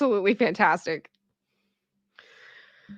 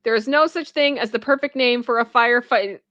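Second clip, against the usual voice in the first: ordinary delivery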